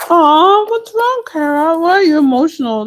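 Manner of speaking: high, cooing sympathy for a sad viewer; tender concern. Real, not acted. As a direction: soothe her like a friend. Comforting an emotional viewer.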